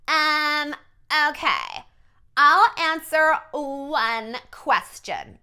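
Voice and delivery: put-on drawn-out, snooty Valley Girl; fake Valley Girl accent. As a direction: mimic a Valley Girl, slow and condescending. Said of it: "she has this weird Valley Girl accent".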